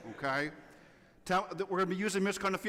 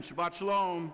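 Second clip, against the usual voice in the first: louder greeting. Shown as a demonstration. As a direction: say it again with more energy. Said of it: "All right, try it again".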